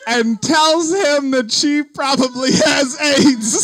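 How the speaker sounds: words squeezed out through loud laughter; can barely get it out for laughing; laughing hard. Real, not acted. Laughing retelling her panic.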